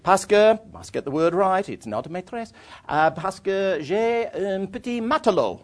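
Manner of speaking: put-on high, English-accented French, sure he has it right; confident schoolboy French. Planning his answer to the second Frenchman, proud schoolboy French. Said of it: "I'm getting better at this".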